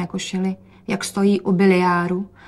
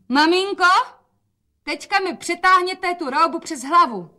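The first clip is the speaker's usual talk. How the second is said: high, raised-voice call; 10 semitones higher, 4 dB louder than usual. A narrator's reading. Daughter wants help with the gown, calling out. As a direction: call mother over in a high, carrying voice.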